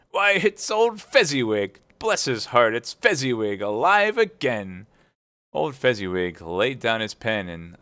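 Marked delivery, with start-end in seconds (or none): 0.1-4.9 great excitement. A narrator's reading. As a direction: burst out with joy at the sight. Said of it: "cried in great excitement".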